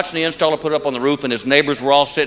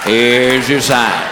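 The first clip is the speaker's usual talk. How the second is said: slow drawn-out Here's your sign, your stressed; slow punchline; 6 semitones lower, much slower than usual. Acted. Comic to the audience, slow deadpan punchline. Recurring punchline delivered slowly.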